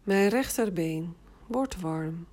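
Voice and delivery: slow, even autogenic formula. Shown as a demonstration. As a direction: say it slowly, for them to repeat. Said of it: "Herhaal twee keer". (langzame formule)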